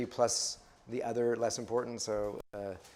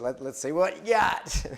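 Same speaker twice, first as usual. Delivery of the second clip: coach voice; 6 semitones higher, 4 dB louder than usual. Retold or imitated. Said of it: "I remember the coach said".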